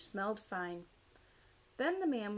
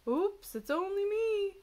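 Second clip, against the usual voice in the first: high, loud singsong bear; big swooping storybook bear voice; bear character; 11 semitones higher, 7 dB louder than usual. A narrator's reading. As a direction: call it out high and cheery-silly. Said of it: "said Bear".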